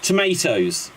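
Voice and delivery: "Tomatoes" with a long AY in the middle; American tomato. Shown as a demonstration. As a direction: say "Tomatoes" as toh-MAY-toes, the American way. Trying the American pronunciation.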